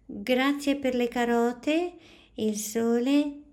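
put-on a small girl sweetly listing thanks; little girl voice. A narrator's reading. Noemi shows her drawing to the teacher, high and sweet.

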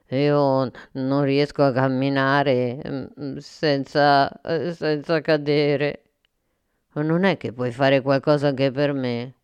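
slow, clumsy monster voice pleading for help; a big lumbering monster asking shyly; slow monster voice asking Sofia for help; monster Gorgotto. Acted.

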